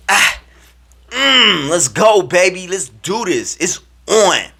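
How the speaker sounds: high, loud, hyped chant; pumped-up celebration. Retold or imitated. Player celebrating on the field, pumped up. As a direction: grunt and fire yourself up. Celebrates after his announcement.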